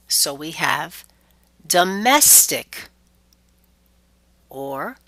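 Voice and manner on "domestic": slow "domestic" with a strong, long MES; stress before suffix. Shown as a demonstration. Teacher shows the -ic rule, "domestic" stressed on MES. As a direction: punch MES in "domestic", taking it slowly. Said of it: "we stress immediately before".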